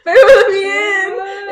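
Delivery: high, slow, whiny plea; pleading man at the door. Retold or imitated. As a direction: beg to be let in, hammed up. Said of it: "And he's like".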